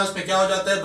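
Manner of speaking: ordinary talk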